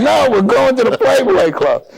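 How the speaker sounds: high, drawn-out retold exclamation; eager insistence. Player overrules teammate about the club, high-pitched eagerness. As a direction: act out gleefully overruling a worried pal.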